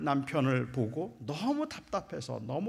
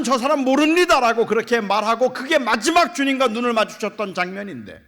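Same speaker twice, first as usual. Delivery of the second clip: loud, higher, driving sermon voice; impassioned preaching; 8 semitones higher, 13 dB louder than usual. Acted. Preacher crying out to the congregation, full force. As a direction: pour it out full force from the pulpit.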